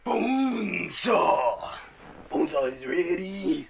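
stretched wrestling roar; Bonesaw call. Pretend. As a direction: boom it out like a ring announcer. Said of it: "wrestling announcer catchphrase".

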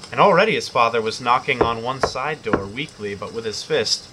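plain reading voice

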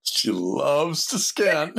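high, drawn-out, laughing delivery; laughing tease. Real, not acted. Teasing Atalia about her scanning, laughing. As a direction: stretch it out through a laugh.

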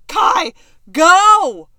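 loud urgent call; urgent shout. Retold or imitated. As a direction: yell it urgently. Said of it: "I'm like".

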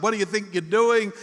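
mildly outraged challenge; indignant crowd member. Acted. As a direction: act out an annoyed bystander. Crowd complains as debris falls.

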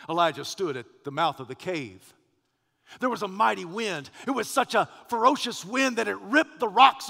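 rising, loud sermon build; preaching. Acted. Preacher building to Horeb before the congregation, loud preaching. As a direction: preach it, building to full force.

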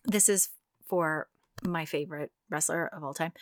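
ordinary talk

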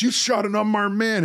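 high, outraged sheriff voice; mock-indignant lawman; a hammed-up sheriff accusing; quoting Gene Hackman's sheriff. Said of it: "And then Gene Hackman says".

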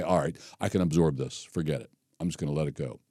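low, soft, forgiving resignation; quiet letting go. Acted. Someone absorbing an offense under a blanket of love, quietly resigned. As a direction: drop the voice and let it go.

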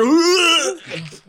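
very loud, high heave; imitated retch. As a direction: mimic a big gag that breaks off the chat. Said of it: "he's just bullshitting, and then".

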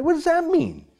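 high-pitched, baffled rhetorical question; incredulous question. Real, not acted. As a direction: throw it out in a high, exasperated pitch. Mocking vague wording of law.

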